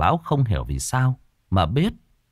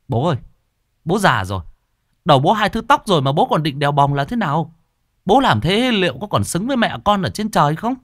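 raised, high scolding voice; children scolding father; 9 semitones higher, 3 dB louder than usual. A narrator's reading. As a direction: scold the old father sharply. Con cái cãi vã với bố.

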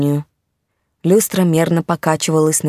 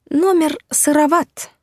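high, slow spider lady voice; exhaled sigh; 11 semitones higher, slower than usual. A narrator's reading. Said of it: "только и смогла выдохнуть она".

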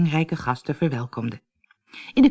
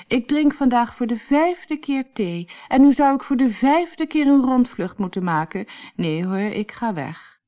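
higher-pitched weary complaint; fed-up sighing; 7 semitones higher than usual. A narrator's reading. Gerda refuses yet another flight, fed-up sighing. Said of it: "zuchtte Gerda".

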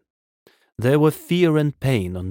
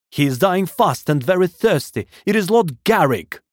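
raised, emphatic, higher voice; urgent plea; 8 semitones higher, 4 dB louder than usual. A narrator's reading. Man begging water for dying Lord Garrick, urgent. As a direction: press the news on with alarm.

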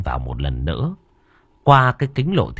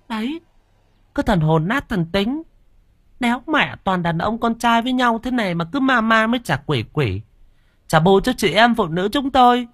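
much higher, sharp sneering woman's voice; shrill mocking wife. A narrator's reading. Sister-in-law mocking the scared men, high and sneering. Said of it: "cất cái giọng cho chả".

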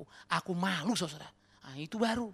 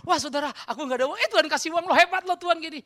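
mock testimony; 9 semitones higher, 10 dB louder than usual. Mocking a shallow testimony.

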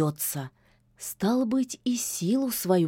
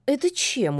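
high, puzzled question; surprised; 6 semitones higher than usual. Acted. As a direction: caught off guard. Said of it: "удивился Баюн".

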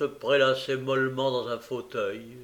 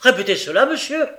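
loud, high angry demand; indignant challenge; 9 semitones higher, 10 dB louder, faster than usual. Acted. Bumble confronting Noé over the kiss, indignant. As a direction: demand it sharply, pitch leaping on MONSIEUR.

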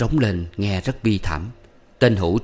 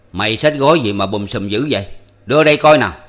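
gruff demand; 2 semitones higher, 7 dB louder than usual. A narrator's reading. Hữu grabbing the boy's bundle.

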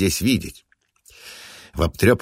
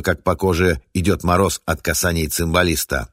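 plain reading voice

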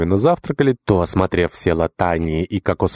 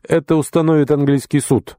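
haughty; 8 semitones higher than usual. Acted. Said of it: "с высоты своего величия процедил тот".

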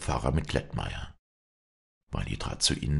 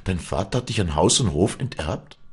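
higher, louder incredulous question; astonished exclamation; 5 semitones higher, 5 dB louder than usual. A narrator's reading. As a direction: ask in shock as the priest. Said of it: "rief der Geistliche".

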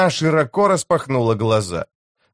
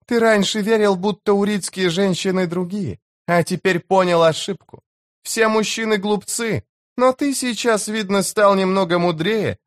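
high-pitched, cool-headed woman's voice; chief's wife, knowing; 9 semitones higher than usual. Acted. Chief's wife warning the enemy leader, knowing and high-pitched. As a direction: answer him high and knowingly.

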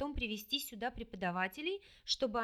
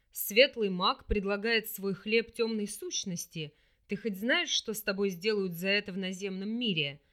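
mustache twirling villain; 3 semitones lower, 5 dB louder than usual. Acted. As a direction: taunt her like a villain. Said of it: "ехидно рассмеялся демон".

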